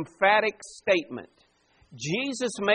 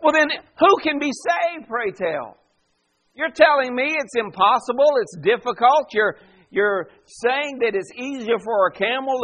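loud, higher-pitched disbelieving questioning; astonished; 4 semitones higher, 4 dB louder than usual. Acted. Disciples questioning Jesus about who can be saved, astonished. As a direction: push back in astonished disbelief, voice lifting. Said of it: "exceedingly astonished and said to him".

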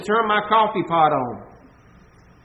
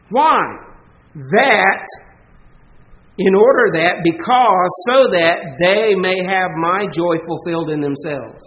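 loud, punched pulpit delivery; loud preaching burst; forceful preaching; 4 dB louder than usual. Acted. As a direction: drive the question home from the pulpit. Preacher building to point.

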